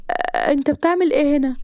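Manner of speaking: stammering, high-pitched shock; startled. A narrator's reading. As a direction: blurt it out, caught red-handed. Caught out, gasps and drops phone. (مخضوضة ومتلخبطة)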